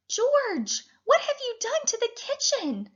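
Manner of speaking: high, voiced mother scolding; a mother shocked at the mess; upset mother. A narrator's reading. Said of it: "The little girl's mother didn't look very happy".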